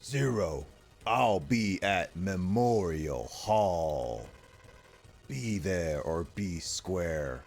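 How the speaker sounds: very slow, drawn-out dramatic read; hammy cutscene character. Acted. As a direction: stretch each word out with mock grandeur. Reading a cutscene villain's lines aloud.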